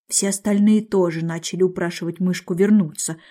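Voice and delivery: ordinary delivery, nothing marked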